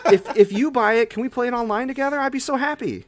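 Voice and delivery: thrilled, pitch riding high; excited. Real, not acted. Host thrilled his co-host may play his favorite game, excited. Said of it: "I'm glad you're so excited".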